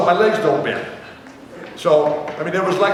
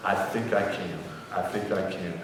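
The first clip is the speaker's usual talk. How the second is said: much softer, lower hopeful murmur; 8 semitones lower, 11 dB quieter than usual. Retold or imitated. Injured player answering the coach, much softer and hopeful. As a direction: plead quietly with sad eyes. Sad, soft eyes.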